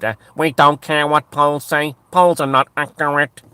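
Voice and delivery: dismissive Irish elite. Retold or imitated. Elite dismissing voters on hate speech law, mock smug official. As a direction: brush off the polls like a smug official. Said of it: "they ignored them and said".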